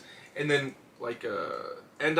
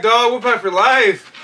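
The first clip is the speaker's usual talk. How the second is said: louder, higher hype call; catchphrase shout; 6 semitones higher, 7 dB louder, slower than usual. Acted. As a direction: proclaim it like a wrestling fan.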